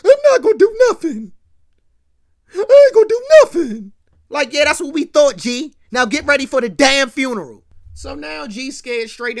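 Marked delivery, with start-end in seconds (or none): 0.1-3.9 high, loud blubbering wail; mock-cry like a scared punk. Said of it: "G start crying like the punk he is". 4.5-7.6 loud, dismissive orders; stern scolding. Retold or imitated. JC orders G after breakdown.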